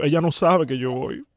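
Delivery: ordinary talk, nothing marked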